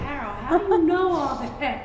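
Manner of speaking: loud, high, laughing disbelief; amazed laughing question. Retold or imitated. Mother marveling at her son's knowledge, laughing loudly. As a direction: marvel at him through a laugh.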